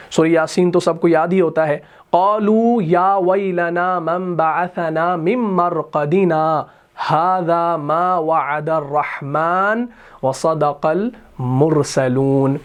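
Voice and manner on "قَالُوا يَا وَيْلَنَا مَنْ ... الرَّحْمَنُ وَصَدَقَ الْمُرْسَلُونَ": slow chanted tajweed, vowels drawn long; Quran recitation. Shown as a demonstration. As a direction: recite the ayah in a melodic chant. Reciting Surah Yasin verse.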